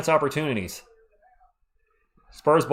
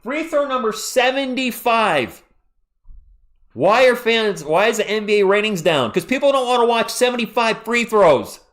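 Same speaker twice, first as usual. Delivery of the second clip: higher, louder ranting complaint; 6 semitones higher, 4 dB louder than usual. Real, not acted. Streamer rants to viewers about free throws, exasperated. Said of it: "I'm on a rant here".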